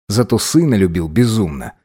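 usual speaking voice

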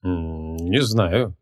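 long low hum before answering; pondering. A narrator's reading. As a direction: hum softly in thought, unsure. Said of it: "задумался маг". (задумчивое мычание, низко и тихо)

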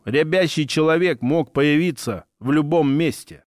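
ordinary delivery, nothing marked

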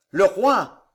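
loud announcement; announcing the king. A narrator's reading. Tavannes announcing the king's entrance, loud. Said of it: "dit Tavannes".

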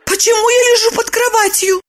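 high-pitched puzzled woman's voice; puzzled Lipochka. A narrator's reading. Woman asks why she woke under the bed, high bewildered voice. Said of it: "спросила она".

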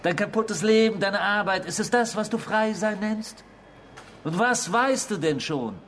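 höher und lauter, spöttisch bohrend; höhnisch. Acted. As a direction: verspotte seinen Freiheitsbegriff. Höhnische Tirade über Freiheit. (höhnische, erregte Tirade)

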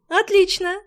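high, loud happy exclaim; delighted. Acted. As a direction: burst out with delight. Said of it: "хлопнула в ладоши магичка".

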